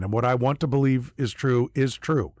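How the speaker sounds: usual speaking voice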